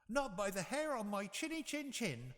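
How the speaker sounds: high squeaky pig voice; a defiant little pig; third little pig. A narrator's reading. Third pig refusing the wolf, high voice. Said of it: "replied the third little pig".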